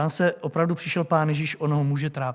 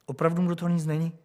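ordinary talk, nothing marked

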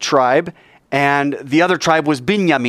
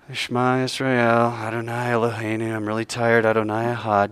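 tired, listless recitation; 3 semitones lower, 5 dB quieter than usual. Shown as a demonstration. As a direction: mumble the prayer as if really tired, barely trying. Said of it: "you can say it like this".

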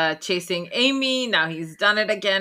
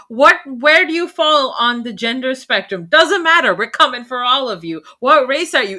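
escalating rant; 7 semitones higher, 5 dB louder than usual. Real, not acted. Ranting that the movie offends everyone.

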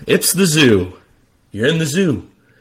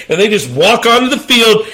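ordinary talk

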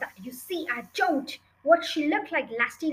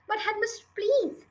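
higher-pitched plea with a falling end; pleading; 8 semitones higher than usual. A narrator's reading. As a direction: beg the headmistress gently. Teacher begs the headmistress.

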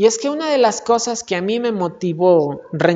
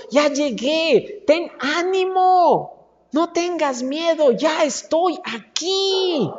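slow reassurance; 7 semitones higher, slower than usual. Acted. Jesus voiced arriving to comfort.

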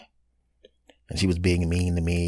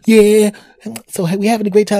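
much higher, loud forced brightness; fake cheerful date; 13 semitones higher, 7 dB louder than usual. Him mocking a cheery date question, high and loud. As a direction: ham up a sarcastic cheery question. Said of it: "what if I had going".

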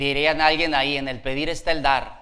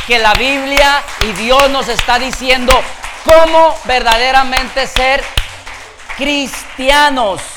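loud, higher rallying sermon voice; rousing preaching; 7 semitones higher, 11 dB louder than usual. Real, not acted. Preacher calling the church to applaud, rousing preaching. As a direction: build to a shout, drawing out the last word.

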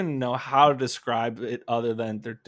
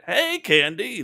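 higher greeting; 7 semitones higher, 4 dB louder, slower than usual. Shown as a demonstration. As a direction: say it high and loud. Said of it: "you could even say it like that".

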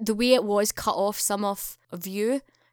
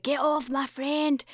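slow, higher mock yell of Tara; 5 semitones higher, slower than usual. Tara defends her friend, a slow, higher mock yell. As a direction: drag out a hammed-up rescue cry. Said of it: "was like".

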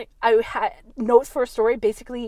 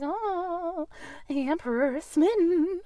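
wavering, wandering pitch; wobbly voice; 8 semitones higher, 3 dB quieter, slower than usual. Retold or imitated. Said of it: "They're trying to do the wobbly voice".

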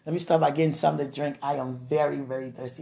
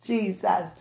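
usual speaking voice

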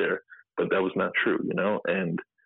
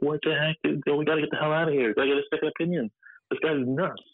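panicked disbelief; 8 semitones higher than usual. Retold or imitated. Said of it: "I'm sitting there like".

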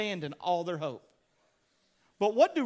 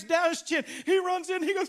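ordinary talk, nothing marked

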